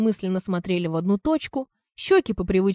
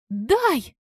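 sudden high-pitched demand; urgent demand; 6 semitones higher than usual. A narrator's reading. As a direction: demand it at once, pitch jumping up. Лизка tries to snatch the paper.

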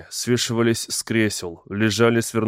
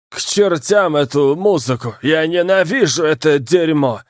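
loud, high furious yelling; drunken yell; 5 semitones higher, 4 dB louder than usual. Acted. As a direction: rage at the music like a drunk. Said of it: "заорал пьяным голосом".

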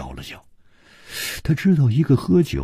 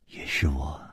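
low, soft, indifferent admission; cool understatement; 5 semitones lower, 10 dB quieter than usual. A narrator's reading. Liu confessing after a long silence, coolly. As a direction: admit it quietly, giving nothing away. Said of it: "淡淡的说".

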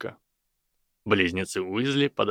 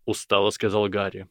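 usual speaking voice

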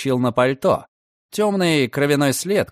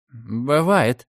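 grumbled. A narrator's reading. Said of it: "буркнул парень".

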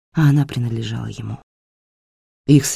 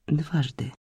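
the word дважды said softly with a falling tune; quiet falling дважды; quiet, flat statement; 9 dB quieter than usual. Acted. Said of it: "тихо поинтересовалась она".

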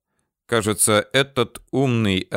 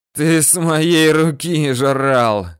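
slow, loud, higher hoarse cry; hoarse wheezing; 6 semitones higher, 5 dB louder, much slower than usual. A narrator's reading. Chief reproaches the man he fed, wheezing hoarsely. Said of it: "хрипит Ахмед".

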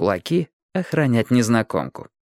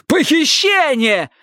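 high, drawn-out coarse yell; coarse shout; 4 dB louder, slower than usual. A narrator's reading. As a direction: holler it roughly like a docker. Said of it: "грубым, как у портового грузчика, голосом".

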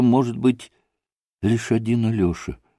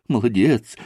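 usual speaking voice

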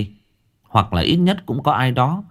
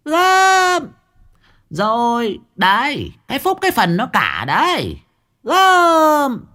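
shrieking moneylender; slower than usual. Acted. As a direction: screech it from the doorstep. Dung shrieks from doorstep. (mụ Dung rít lên the thé)

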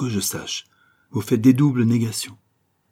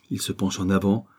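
plain reading voice

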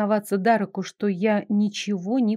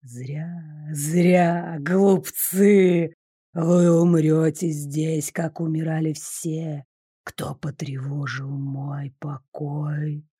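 low, slow spectral voice full of hate; hissing ghost; 6 semitones lower, much slower than usual. A narrator's reading. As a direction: hiss it like a vengeful ghost. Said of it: "злой, свистящий, преисполненный ненависти".

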